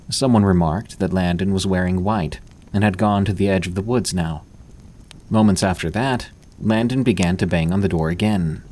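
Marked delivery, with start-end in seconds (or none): none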